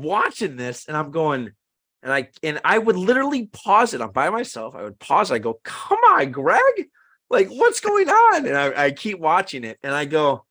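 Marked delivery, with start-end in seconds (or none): 5.6-8.5 much higher and louder, pleading in disbelief; exasperated. Retold or imitated. Pausing the movie alone to complain to Greg, high exasperated whine. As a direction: whine it at the screen, slow and drawn out.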